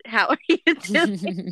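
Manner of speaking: slow question, laugh breaks in. Shown as a demonstration. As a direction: ask it again through a giggle. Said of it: "I just did".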